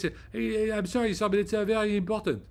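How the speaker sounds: high-pitched imitation of a French accent; put-on French accent, high and polite; mock French accent. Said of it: "they speak like this".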